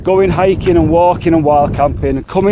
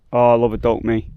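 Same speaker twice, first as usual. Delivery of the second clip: normal talking voice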